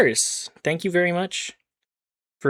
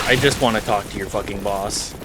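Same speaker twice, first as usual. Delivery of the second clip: ordinary talk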